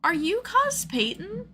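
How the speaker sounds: high-pitched, drawn-out polite question; mock approaching fan. Fan walking up to ask the cosplayer, high-pitched tentative question. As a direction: voice a fan's careful, high approach.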